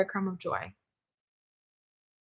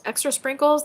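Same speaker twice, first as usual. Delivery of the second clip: higher and brighter repeat; excited echo of a comment; 6 semitones higher than usual. Real, not acted. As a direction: savour the words with delight.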